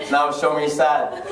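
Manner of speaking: low, slow father's command; stern dad. Acted. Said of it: "That would never work with my dad".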